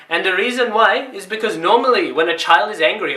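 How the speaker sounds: ordinary talk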